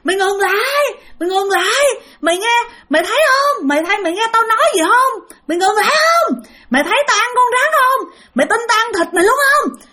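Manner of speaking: high ghost calling after the fleeing farmer; chasing ghost. Acted. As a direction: yell after the fleeing man like a demon. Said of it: "nghe nó cười ha hả phía sau".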